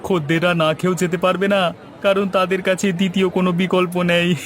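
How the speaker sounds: ordinary delivery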